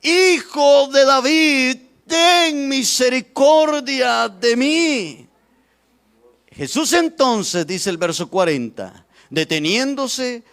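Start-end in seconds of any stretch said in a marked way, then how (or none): 0.0-5.3 high, loud, very drawn-out plea; clamoring louder. Acted. Blind beggar refuses to be silenced, clamoring for mercy. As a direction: stretch each word into an insistent cry. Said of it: "él clamaba mucho más".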